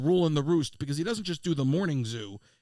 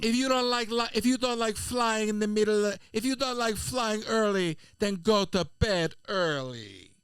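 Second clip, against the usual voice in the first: higher-pitched mock Jamaican scolding, slowing to the end; scolding Jamaican accent; 5 semitones higher than usual. Passenger lecturing the flight attendant, imitated Jamaican accent. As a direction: lay down the law in a put-on Jamaican accent. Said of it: "she's just reading the riot act".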